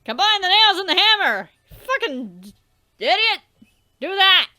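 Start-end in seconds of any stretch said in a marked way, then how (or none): none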